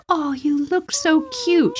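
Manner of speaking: put-on high, cooing little-girl voice; cooing child voice. Little girl coos to her dressed-up cat, high and sweet. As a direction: gush over the cat like a small child. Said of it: "and I'm like".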